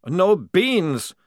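high, loud anguished moan. Acted. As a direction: groan the loss aloud. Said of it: "moaned Maledict".

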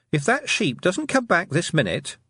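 mild shout. A narrator's reading. Tiffany threatens the unseen thieves at the sky, mild shout. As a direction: call out a warning, pitched high. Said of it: "she shouted at the sky".

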